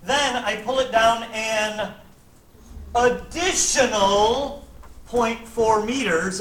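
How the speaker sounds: slow, leaning hard on "additional"; teacher spelling out the key word "additional" slowly; stress on additional. Shown as a demonstration. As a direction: drag out "additional point four meters" so it can't be missed. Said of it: "Why did I emphasize the word additional?".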